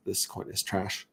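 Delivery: a whispered verdict on the coin; whispered aside. Real, not acted. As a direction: whisper "trash" like a secret. Aside about bad coin.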